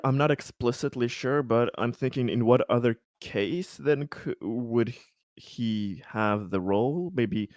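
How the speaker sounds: usual speaking voice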